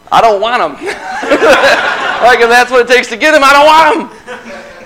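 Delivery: high, loud laughing protest; giggling I don't want 'em, slowing at the end; laughing refusal. Retold or imitated. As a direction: laugh through the no, pitch climbing. Retold refusal of the boots, laughing.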